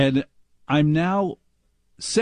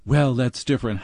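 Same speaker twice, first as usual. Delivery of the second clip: normal talking voice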